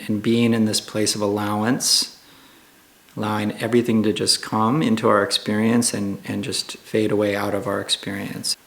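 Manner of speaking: calm, hushed meditation guide with long pauses; guided meditation. Acted. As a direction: ease listeners gently into stillness, unhurried and even.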